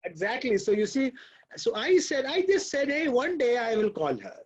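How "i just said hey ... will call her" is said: ordinary talk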